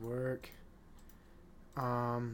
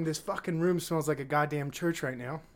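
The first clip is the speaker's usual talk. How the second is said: ordinary delivery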